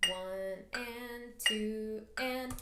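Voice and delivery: very slow, even count with the metronome; "One and two and" stretched evenly. Shown as a demonstration. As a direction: count it out slowly, beat by beat. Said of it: "this is what it'll sound like".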